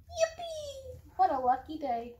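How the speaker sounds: squealed high yippee in a put-on voice; happy duck. Voicing the duck for her cats, squealing delight. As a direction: cheer like a delighted little duck.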